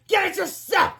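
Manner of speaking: high, loud retort; shouting back. A narrator's reading. Secretary answering the principal, shouting back. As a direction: fire it back in a high yell. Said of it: "Miss Anthorp shouted back".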